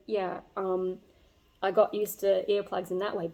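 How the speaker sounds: ordinary delivery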